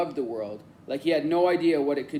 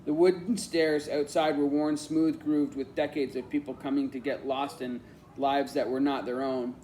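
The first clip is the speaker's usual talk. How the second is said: plain reading voice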